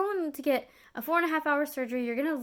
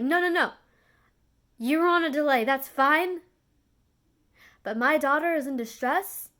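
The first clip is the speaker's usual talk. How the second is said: angry father; 4 semitones higher, 5 dB louder than usual. Retold or imitated. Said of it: "my dad looked at him and said".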